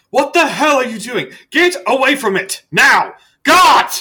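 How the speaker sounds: high, very loud outraged yell; angry shout. Acted. Researcher catches someone pulling the wrong wire, furious shouting. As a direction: shout them away from the equipment in fury. Researcher yells at meddling player.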